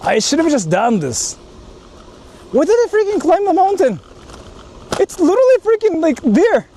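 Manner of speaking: ordinary talk, nothing marked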